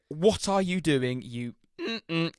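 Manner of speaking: high, slow mock yell at the driver; angry man yelling in the driver's ear; retold scream. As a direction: play the furious passenger, hammed up. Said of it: "screams at the top of his lungs".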